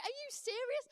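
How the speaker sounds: high, loud incredulous question; retold "you can't mean it" outburst; acted disbelief. Acted disbelief at being told stand.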